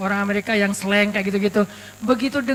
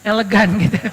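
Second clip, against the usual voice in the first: elegan said through a little laugh; 3 semitones lower than usual. Real, not acted. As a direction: chuckle on elegan as you explain.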